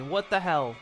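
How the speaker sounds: usual speaking voice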